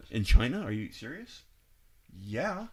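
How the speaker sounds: usual speaking voice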